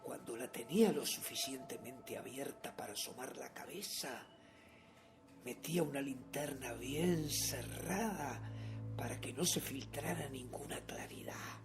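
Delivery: low, very soft storytelling; near-whispered, secretive confession; hushed stealth. Acted. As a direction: tell it as if creeping through a sleeper's door. Recounts stealthy creeping into room.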